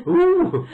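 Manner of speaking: a high, loud mock ooh; teasing high ooh from the rowers. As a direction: hoot like impressed crewmates.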